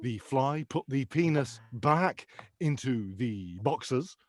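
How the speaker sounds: slow, measured chef commands; Gordon Ramsay. Acted. Chef finishing his urinal instructions, slow mock coaching. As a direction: finish the steps in brisk separate phrases, drawn-out pace. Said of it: "visions of him telling me what to do".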